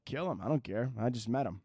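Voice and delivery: soft, offhand dismissal; quietly throwing someone to the wolves; mock indifference. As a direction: shrug it off under your voice. Acts indifferent reply to robber.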